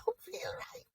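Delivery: high, whispered mock weakness; feeble confession. Speaker admits some mornings feel off, whispering feebly. Acting out waking up low.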